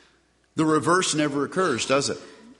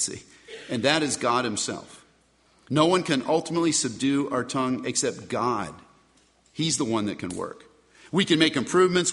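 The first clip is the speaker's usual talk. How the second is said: normal talking voice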